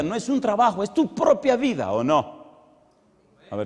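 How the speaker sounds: usual speaking voice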